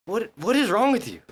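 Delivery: high-pitched imitation of an exasperated sergeant; berating sergeant. Sergeant confronts trainee on the run back, mock exasperated scolding. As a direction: demand it in mock outrage. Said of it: "he's like".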